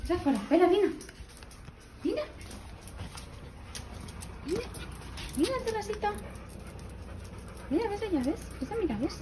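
high, soft coaxing pet voice. Real, not acted. Showing the dog its house.

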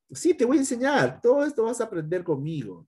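laughing. Real, not acted. Laughing reply.

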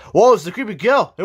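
normal talking voice

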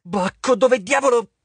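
panicked, furious yell; alarmed shout. Acted. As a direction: demand an answer from the god. Jason shouts at vanished god.

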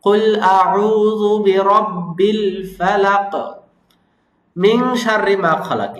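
slow, drawn-out tajweed chanting; measured Quranic recitation. Shown as a demonstration. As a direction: recite it slowly and melodically. Recites the verses.

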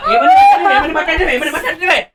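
high, mock wailing voice; mocking squeal. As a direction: mimic her cry in a squeaky voice. Husband mocks wife's cry. (వెక్కిరిస్తూ ఎత్తైన గొంతు)

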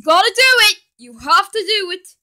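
loud, high pushing demand; insistent shouting. Acted. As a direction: egg them on loudly. Insisting on dare.

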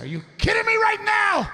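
high-pitched incredulous screech; pissed-off wife. Acted. Wife to winning husband, pissed-off screech. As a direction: screech it in mock fury. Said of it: "She was pissed".

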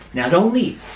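ordinary talk

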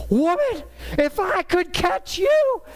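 high-pitched, drawn-out mock scream; retold screaming. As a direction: scream it after someone you can't catch. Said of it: "I start screaming".

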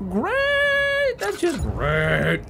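high, drawn-out sarcastic squeal; hammed-up falsetto sarcasm; mock delight. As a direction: stretch it out in overblown fake delight. Sarcastic reaction to injuries.

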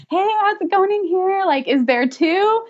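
high, playful sing-out, imitating a visitor popping in; kidding midwife. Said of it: "she was completely kidding".

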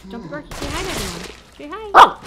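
high coaxing to dog, bursting loud on say hi; coaxing pet voice. Real, not acted. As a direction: coax the dog to greet.

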